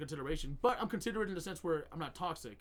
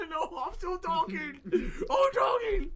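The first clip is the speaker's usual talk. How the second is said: very high, loud, drawn-out yell; mock protesting shout; 13 semitones higher, 9 dB louder, much slower than usual. Guest refusing moderator's interruption, hammed-up shouted protest. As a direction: stretch it into a hammed-up holler.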